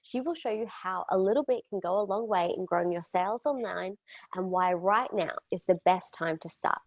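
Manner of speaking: ordinary talk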